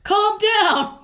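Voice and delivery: loud, high-pitched cop's bellow. Retold or imitated. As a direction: bark it as a sudden order. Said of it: "and then suddenly bellows".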